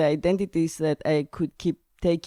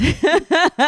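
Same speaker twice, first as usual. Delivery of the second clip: a burst of high laughter; high bright laugh; 10 semitones higher, 5 dB louder than usual. Real, not acted. Laughing at breaking the rules. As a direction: laugh out loud at the joke.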